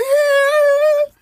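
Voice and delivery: very high, loud whooping cheer; crowd cheer. Retold or imitated. Fans cheering Mick Foley in the crowd, loud high whoop.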